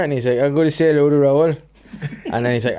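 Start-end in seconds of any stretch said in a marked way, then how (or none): 0.4-1.5 loud, raised Geordie-ish Gazza voice; mock Gazza blurting it out; Gazza impression. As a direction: ham up a drunk footballer's cheer. Gazza voiced in retold interview.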